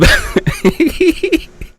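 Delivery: high-pitched laugh; real high giggle. Real, not acted. Laughs at show name.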